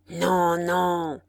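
terrible cry. A narrator's reading. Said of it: "cria tout à coup l'homme avec une voix terrible".

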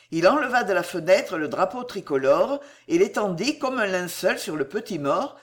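ordinary delivery

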